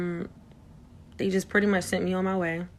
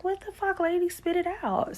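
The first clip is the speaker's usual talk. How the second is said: higher, impatient retold snap at the doctor; mock exasperated outburst; 13 semitones higher than usual. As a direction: demand she spit it out, voice up high.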